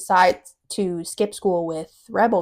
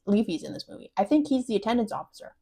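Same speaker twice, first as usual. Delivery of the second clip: ordinary talk, nothing marked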